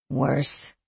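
low, soft, dejected sigh. A narrator's reading. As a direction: let the word sink out on a tired sigh. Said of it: "she sighed".

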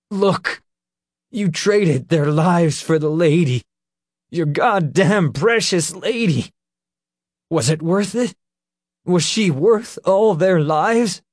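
high, loud furious yelling. A narrator's reading. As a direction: scream the accusation at him. Nick screams accusation.

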